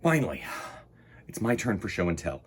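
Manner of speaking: ordinary delivery, nothing marked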